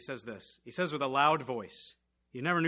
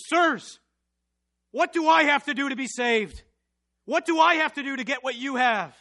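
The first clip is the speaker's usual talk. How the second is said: high, loud begging; desperate plea; 8 semitones higher, 9 dB louder than usual. Acted. Jailer begging the preachers, high and loud. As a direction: plead for your life.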